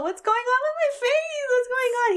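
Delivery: high squealed mock despair; highest pitched voice. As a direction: replay the distressed squeal. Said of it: "in probably my highest pitched voice".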